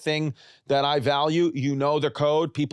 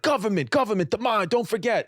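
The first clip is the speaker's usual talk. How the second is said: mock street-preacher rant about government; ranting train preacher; 7 semitones higher than usual. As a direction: ham up the crazed subway preacher. Ranting man leaving train.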